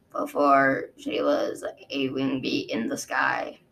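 low, measured guard recounting; a dragon guard explaining to his queen, low and sober; Osprey, low and grave. Acted. Osprey voiced in story.

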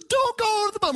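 put-on very high childlike plea; pleading little boy. Son begging dad not to hit the bump, very high kid voice. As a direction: beg like a small scared boy. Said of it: "He said".

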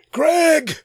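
high, loud call across the field; retold scream across field. Coach yelling across the field at a parent to mask up, loud high shout. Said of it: "I scream it across the field".

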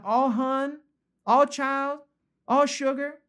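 high, drawn-out Southern belle lilt; Southern belle accent. Acted. Preacher imagines the author soothing readers, high Southern belle accent. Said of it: "Like down in the South".

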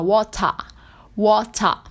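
"water" with a crisp T and no final R; British water. Shown as a demonstration. Teacher showing the British way, "water" with a real T. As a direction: say "water" as WAW-tah, British style. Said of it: "em que predomina o sotaque britânico".

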